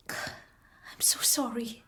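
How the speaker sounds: slow, whispered apology. Acted. Christine telling Philip she's sorry, slow whisper. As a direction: let each word drop in a whisper.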